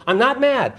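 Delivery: high, loud and laughing through it; laughing quote. Retold or imitated. Telling the wife's worried husband on the phone, laughing, that he is not mad. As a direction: laugh while insisting you're not mad. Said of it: "and he goes".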